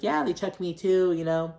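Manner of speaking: mock Kyle. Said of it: "Kyle's like".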